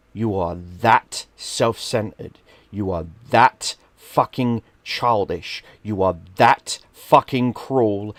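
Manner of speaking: slow, heavy, each "that" hammered; stern father lecture. Retold or imitated. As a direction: deliver it like a disappointed dad scolding.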